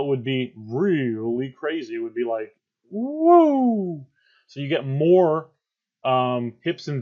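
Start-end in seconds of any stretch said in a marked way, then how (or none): 2.9-4.1 high, loud, drawn-out woo; excited sound effect, pitched way up; high swooping "woo". Shown as a demonstration. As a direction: whoop like a wild roller-coaster curve. Imitates a wildly oscillating graph.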